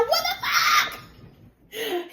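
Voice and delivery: very high, drawn-out squeal; shocked outburst. Retold or imitated. Retelling own shocked reaction.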